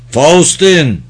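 loud, high call, falling at the end; shouting. A narrator's reading. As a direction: shout the name after a fleeing man. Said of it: "diye aykırdı". (arkasından yüksek sesle haykırarak)

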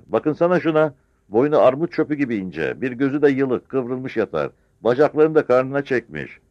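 high, loud, scornful harangue; scolding village woman. Acted. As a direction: bawl out the whole village. (köylüleri azarlayan yaşlı kadın)

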